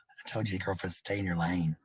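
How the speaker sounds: ordinary delivery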